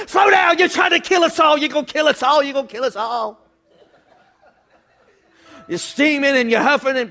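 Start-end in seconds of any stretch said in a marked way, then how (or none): 0.1-3.4 high panicked scolding, easing off as it repeats; mock yelling wife. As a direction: shriek at the driver, then taper down. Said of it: "she's yelling at you".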